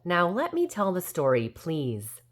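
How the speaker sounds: usual speaking voice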